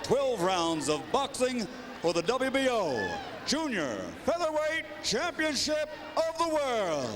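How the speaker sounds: booming ring announcer call. Acted. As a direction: announce it to the whole arena.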